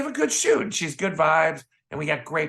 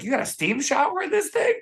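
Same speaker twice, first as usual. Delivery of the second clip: mock excited question; 13 semitones higher than usual. Retold question on private plane.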